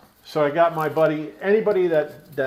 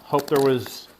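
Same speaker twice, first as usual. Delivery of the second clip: ordinary talk, nothing marked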